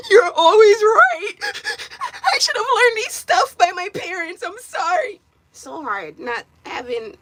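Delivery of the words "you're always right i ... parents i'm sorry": much higher, louder mock sobbing plea; imitating own bawling. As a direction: wail it out like a crying apology.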